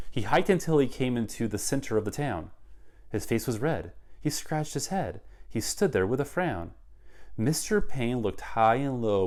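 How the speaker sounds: put-on lively rhyming read-aloud for kids; story narration. A narrator's reading. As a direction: read it like a picture book to children. Reads picture book aloud.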